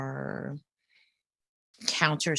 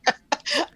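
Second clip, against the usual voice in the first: bright burst of laughter; 13 semitones higher than usual. Real, not acted.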